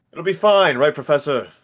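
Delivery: high, loud, appealing to the professor; breezy loud reassurance. Acted. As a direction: call it out high and confident. Brushing off worry, calls to colleague.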